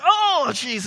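high, loud dismay; shocked reaction. Retold or imitated. Dad reacting to the car price, high and loud dismay. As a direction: recoil at the price. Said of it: "It's like".

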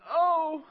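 loud, high-pitched "oh" of pain; re-enacted pain cry. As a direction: yelp as the needle goes in. Acts out pain of next shot.

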